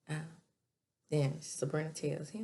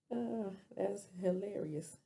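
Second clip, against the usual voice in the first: normal talking voice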